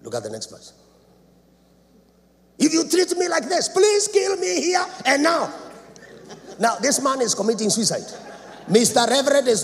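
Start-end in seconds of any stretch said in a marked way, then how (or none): 2.6-5.5 desperate plea. Acted. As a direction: plead it out like a broken man. Moses begging for death.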